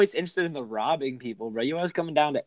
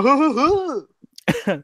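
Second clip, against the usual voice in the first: excited hooting; 11 semitones higher, 3 dB louder, much slower than usual. Retold or imitated. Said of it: "He's like".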